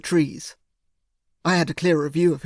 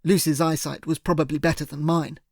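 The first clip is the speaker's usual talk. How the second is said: ordinary delivery, nothing marked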